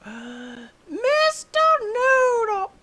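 gasp, then stretch it out very high and slow; a swooning high sigh of the name; gasping high drawl. Pretend.

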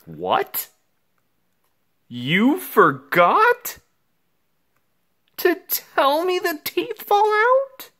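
high, stretched shocked cry; outraged disbelief. Acted. Kid told late that teeth fall out, shocked squeal.

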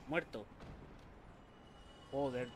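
ordinary delivery

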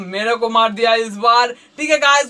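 usual speaking voice

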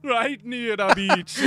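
put-on slow Jamaican rude boy echo. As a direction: drawl it back in patois. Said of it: "rude boy voice".

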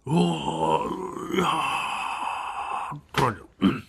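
shaky, crying-out fear; the roommate wailing his friend's name in fright; frightened wail. Retold or imitated. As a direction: let the fright spill out in a wail.

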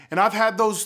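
ordinary delivery

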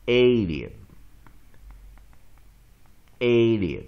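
ALIEN with a loud, long A in the first syllable. Shown as a demonstration. Teacher modelling a word for students, long A in "Alien". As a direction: draw out "Alien" slowly, stressing the long A. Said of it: "所以这A要念长母音A".

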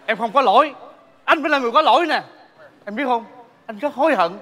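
pleading. Acted. Husband pleading with wife.

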